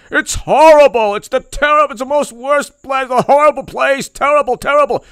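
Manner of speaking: high, loud hammed-up wail; mock politician wail. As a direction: shriek it like a cartoon. Mocking Cuomo trashing country.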